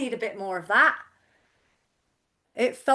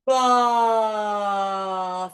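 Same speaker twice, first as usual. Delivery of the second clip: a long, steady, louder "Bra." held on one tone; open bra exercise; 5 dB louder than usual. Shown as a demonstration. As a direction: release "Bra." freely from an open throat. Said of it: "keeping the pressure off the throat with that bra".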